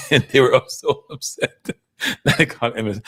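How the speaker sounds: chuckling through the words; laughing. Real, not acted. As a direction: laugh while telling it. Laughing while retelling friend's anger.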